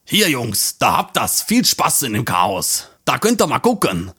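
a beer-drinking guy chuckling it off; amused slob. Acted. Man in bathrobe hands the drawer over, low chuckling voice. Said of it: "Lacht der Typ".

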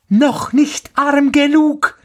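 very high, slow bird voice; taunting sparrow. A narrator's reading. (hoch und gedehnt höhnender Spatz)

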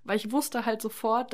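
ordinary delivery

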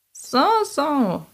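drawn-out, high witch's growl of approval; approving growl. A narrator's reading. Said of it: "knurrte die Hexe anerkennend".

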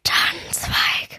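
slow, drowsy whisper; sleep whisper. Acted. The mouse Hilde, asleep in the mole's burrow, whispering. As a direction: murmur it as if you are dreaming.

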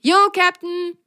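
high, eager call of a little sock; high playful aye-aye; adventurous. Acted. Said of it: "rief Ro abenteuerlustig".

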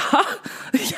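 short breathless disbelieving laugh; a breathy half laugh of shock; stunned laugh. Retold or imitated. Answering the shocked bystander, breathy disbelieving laugh.

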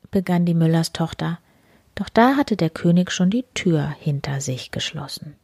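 ordinary delivery, nothing marked

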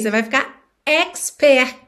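ordinary talk, nothing marked

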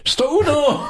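put-on high squeaky old lady calling; high excited old woman greeting; shrill old lady. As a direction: play a delighted old aunt. Comic skit, old ladies greeting.

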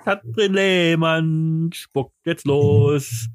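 slow announcement. Acted.

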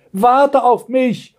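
high, drawn-out rabbit call; wolfish rabbit. A narrator's reading. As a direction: call out slowly, high-pitched. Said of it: "mit einer Stimme, die eher an einen Wolf erinnerte". (hoher, gedehnter Ruf des Kaninchens)

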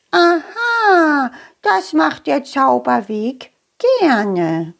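high squeaky mole; small creature, knowing; little mole voice. Acted. Mole character speaks.

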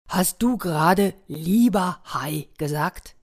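slow, drawn-out creature voice; surprised shark. Acted. Shark asks the boy about being called dear, slow surprised shark voice. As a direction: ask slowly, as if hardly believing it.

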